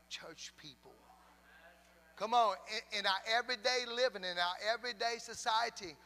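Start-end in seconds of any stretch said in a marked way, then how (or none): 2.2-6.0 raised-pitch insistent exhortation; preaching. Real, not acted. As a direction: urge the church on, building by repetition. Preaches to the congregation.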